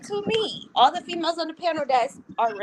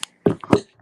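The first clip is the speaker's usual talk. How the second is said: laughing; 6 dB louder than usual. Real, not acted. Laughing at the dance.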